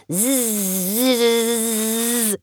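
buzzing like a drill, zzzz; dentist drill buzz. Acted. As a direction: imitate the whirr of a dentist's drill. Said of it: "komisches, surrendes Geräusch". (surrendes Bohrergeräusch)

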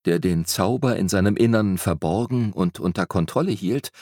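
ordinary delivery, nothing marked

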